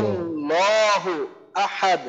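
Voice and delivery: very slow ওয়াল্লাহু আহাদ with a heavy lam; careful slow ওয়াল্লাহু আহাদ; slow recitation. Shown as a demonstration. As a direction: draw out ওয়াল্লাহু আহাদ after the spelling. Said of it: "বানান করেও পড়ি".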